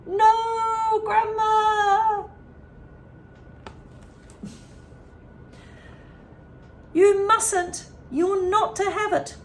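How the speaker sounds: high, loud desperate plea; panicked boy. A narrator's reading. George desperately begging Grandma not to drink, high and loud. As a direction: beg her not to in high alarm.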